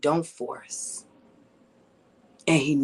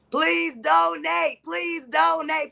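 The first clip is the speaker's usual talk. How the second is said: pleading shout; 13 semitones higher, much slower than usual. Real, not acted. Urgent appeal to viewers.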